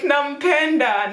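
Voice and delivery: high, drawn-out lament; stretched mock-crying whine; imitated tearful wail. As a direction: re-enact the heartbroken wail, milder. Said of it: "that's how I was crying".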